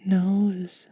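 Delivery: ordinary delivery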